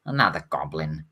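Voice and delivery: low, softened mumble; quiet aside to oneself, pitch dropping; mumbling to himself. Acted. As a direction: mumble it under your breath.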